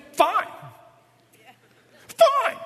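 shrill back at them, climbing; escalating fine. Retold or imitated. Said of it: "how that one usually is responded to".

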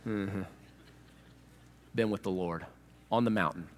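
low, soft false modesty; mock humblebrag. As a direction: pretend to be casually holy, voice dropped low with long pauses. Humblebragging faster, mocked.

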